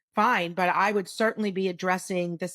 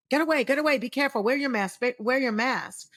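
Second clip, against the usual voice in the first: higher-pitched, imitated anxious parent shooing a child away; urgent parental warning; 5 semitones higher, faster than usual. Parent warning a child near adults during covid, urgent.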